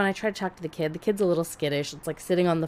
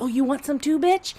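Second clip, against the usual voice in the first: high, louder mock challenge; imitated yelling; 9 semitones higher, 4 dB louder than usual. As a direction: act out an aggressor turning on someone, pitch climbing. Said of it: "starts yelling at me again".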